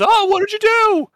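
put-on a nerd shrieking at the girlfriend who broke his model; horrified nerd shriek. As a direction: squeal in horror over the broken model. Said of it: "And he says".

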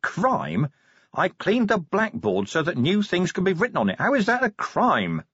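incredulous commander, climbing in pitch; Vimes, indignant. A narrator's reading. Commander defends cleaning a blackboard, indignantly.

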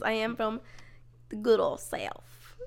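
slow, drawn-out Southern accent. Shown as a demonstration. Showing off a Southern accent to a friend, Southern drawl. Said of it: "this one's gonna be Southern".

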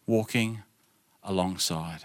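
quiet, reflective repetition; soft repeat. Real, not acted. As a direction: let it sink in, almost under the breath.

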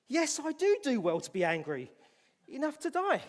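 Jonah's bitter shout that he is right to be angry; defiant angry outburst. Acted. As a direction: fling the answer back at God. Jonah defiantly answers God.

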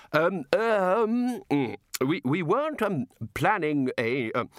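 high, stammering reluctance; flustered hesitation. Acted. Wizard refusing the god a demonstration, flustered. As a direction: grope through ums for a polite refusal.

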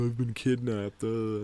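whiny, complaining kid imitation; mock whining. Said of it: "he's all whiny and complaining".

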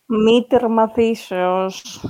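slow, drawn-out solemn phrase; mock ancient Greek. Host dropping an archaic phrase on co-host, drawn out. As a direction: stretch each syllable out grandly. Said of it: "πώς τα λέει έτσι".

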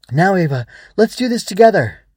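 high, loud and building; rallying cry. Acted. Swoosh rallies Ava for the fight, shouting. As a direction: call her into battle, triumphant.

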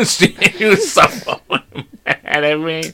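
words broken up by laughter; laughing. Real, not acted. Dad recalling the angry lady, helpless laughing. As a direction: struggle to talk through real laughter.

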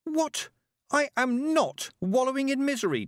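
put-on very high, indignant girl; contained shout, pitch right up. A narrator's reading. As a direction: protest in outraged, high-pitched fury. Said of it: "Tiffany shouted".

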